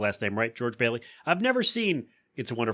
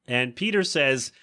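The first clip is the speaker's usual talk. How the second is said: ordinary delivery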